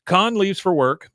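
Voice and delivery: ordinary delivery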